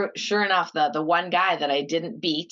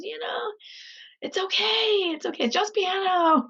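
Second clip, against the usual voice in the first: imitating mom's soothing, much higher; gently calm a crying child; mock soothing mom. Mom counsels crying daughter.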